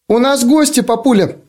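put-on high squeaky four-year-old girl; squeaky little girl voice. A narrator's reading. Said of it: "писклявым голоском четырёхлетней девочки".

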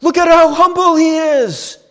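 high, loud gushing praise; admiring crowd. Retold or imitated. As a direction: gush over his humility. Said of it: "They're not like".

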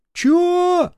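very high, loud "what?"; squawked, loud question; shouted query. Acted. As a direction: yell back as if unable to hear. Cannot hear over the engine.

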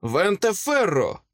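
high-pitched magical command; spell casting. A narrator's reading. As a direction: call out an incantation. Wizard casts a spell.

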